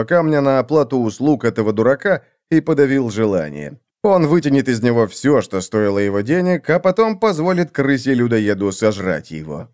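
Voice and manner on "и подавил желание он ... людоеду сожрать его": ordinary delivery, nothing marked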